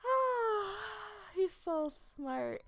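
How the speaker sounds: high, slow, dreamy swoon; swooning admiration. Retold or imitated. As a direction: melt over how smart he is. Swooning over her man.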